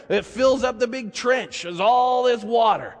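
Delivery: ordinary talk, nothing marked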